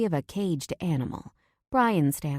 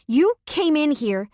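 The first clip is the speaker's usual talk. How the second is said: much higher and louder, stress on you; shouting; 10 semitones higher, 6 dB louder than usual. A narrator's reading. Wife repeating accusation to husband in a shout. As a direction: hurl the repeated words at him.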